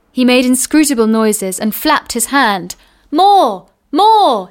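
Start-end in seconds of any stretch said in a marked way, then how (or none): 3.1-4.5 high, loud, insistent demand; demanding giant. A narrator's reading. Giant demanding another bag from the loaders, high insistent call. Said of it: "he said".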